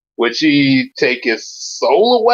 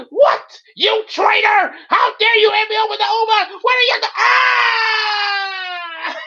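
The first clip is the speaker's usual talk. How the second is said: outraged yell; slower than usual. Acted. Betrayed brother yells at the traitor, outraged. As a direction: shout at the traitor in disbelief.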